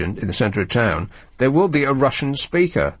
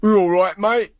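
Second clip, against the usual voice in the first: mock Cockney greeting; 9 semitones higher than usual. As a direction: ham up a fake Cockney geezer greeting. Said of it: "a fake accent".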